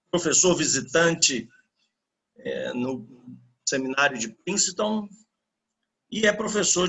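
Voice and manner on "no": ordinary talk, nothing marked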